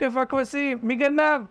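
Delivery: high, anxious calling for lost sheep; mock shout. Farmer yelling for his runaway sheep, high and calling. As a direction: call out, searching in alarm. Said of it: "התחיל לצעוק".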